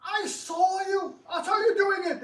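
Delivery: outraged accuser crying foul; shrill accusation. Acted. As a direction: point the finger and wail it out. Accusing opponent of cheating.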